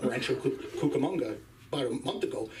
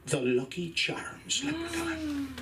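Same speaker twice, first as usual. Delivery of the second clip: ordinary talk, nothing marked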